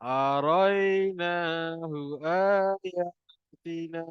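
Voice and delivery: very slow drawn-out Quran recitation. Shown as a demonstration. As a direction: recite slowly for students to repeat. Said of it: "ikut saya baca".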